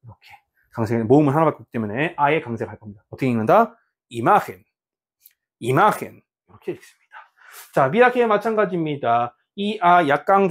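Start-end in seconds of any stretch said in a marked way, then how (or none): 4.1-6.2 "이마헨" with 마 stressed; stress on penultimate syllable. Shown as a demonstration. Teacher reads out the example, "이마헨" stressed on 마. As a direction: punch the middle syllable 마 of "이마헨". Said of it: "마니까 마의 강세가 있겠고".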